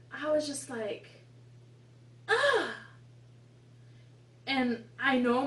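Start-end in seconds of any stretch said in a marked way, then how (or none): 2.3-2.7 high, loud groan of disgust; a sharp, high "Ugh" of contempt. Retold or imitated. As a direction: let out an exasperated ugh. Disgust at snobby girl.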